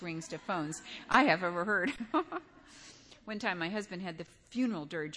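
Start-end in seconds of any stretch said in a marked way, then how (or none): 2.0-3.2 short, high breathy laugh; amused chuckle. Real, not acted. Speaker to congregation about a ringing phone, amused chuckle. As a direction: chuckle at the interruption.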